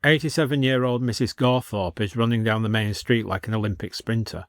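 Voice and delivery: plain reading voice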